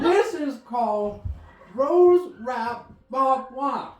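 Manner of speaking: put-on laughing old Louisiana man voice, dragged out; Mudbone impression. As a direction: play the grandfather doing his Mudbone voice. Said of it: "Granddad sounds like Mudbone".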